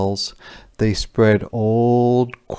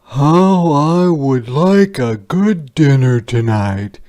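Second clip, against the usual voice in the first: higher, louder, longing for a dinner; tired soldier voice; 6 semitones higher, 7 dB louder than usual. Acted. Hungry soldier wishing for dinner, raised storyteller voice.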